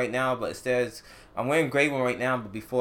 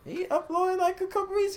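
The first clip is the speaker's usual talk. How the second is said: squeaky high voice. Acted. Sudden high squeaky reply.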